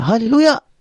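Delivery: high call of hallelujah; rising high hallelujah; calling out to stop. Acted. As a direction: call out to halt the horse. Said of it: "So, sabi niya".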